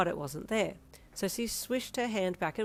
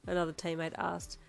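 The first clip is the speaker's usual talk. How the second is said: ordinary delivery, nothing marked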